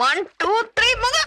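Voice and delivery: high, climbing count; excited countdown. Acted. Girl starts a breath-holding game, excited countdown. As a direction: count up with rising pitch to the start.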